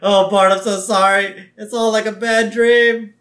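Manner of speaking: loud, high mock wail of apology; hammed-up cartoon girl crying out; imitated distraught Lisa. Sister apologizing to her fallen brother, loud mock anguish. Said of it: "she's like".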